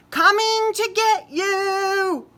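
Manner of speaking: high, loud, slow playful boom; loud booming call. Acted. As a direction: boom it like a big bear playing. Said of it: "Boris boomed".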